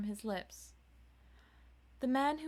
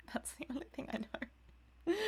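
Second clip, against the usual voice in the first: laughing aside; 6 semitones higher than usual. Real, not acted.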